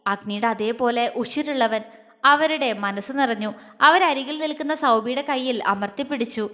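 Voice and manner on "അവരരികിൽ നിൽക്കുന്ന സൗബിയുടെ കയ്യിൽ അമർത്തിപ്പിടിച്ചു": usual speaking voice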